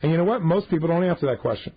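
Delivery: normal talking voice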